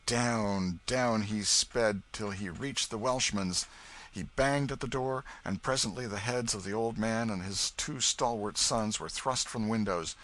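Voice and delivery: plain reading voice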